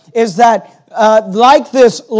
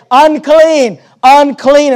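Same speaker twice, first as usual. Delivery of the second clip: yelled warning; 4 semitones higher, 4 dB louder, much slower than usual. Retold or imitated. Leper to people walking towards him, yelled warning. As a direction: imitate a leper's warning yell, higher pitched. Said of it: "cry means to yell and say".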